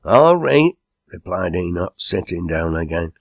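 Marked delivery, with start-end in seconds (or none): 0.1-0.7 loud, high "all right"; protesting retort. A narrator's reading. Retorts on being called to order.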